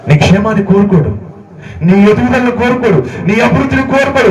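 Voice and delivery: preacher gathering steam; rising sermon build. Acted. Preacher builds to full force.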